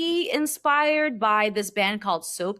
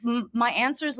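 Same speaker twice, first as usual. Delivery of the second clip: usual speaking voice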